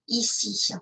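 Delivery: ordinary talk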